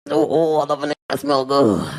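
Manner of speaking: low, quick purring grandma voice; husky grandma swooning over his cologne; lusty grandma. Acted. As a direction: drop low and leer at him. Acting out flirty grandma lust.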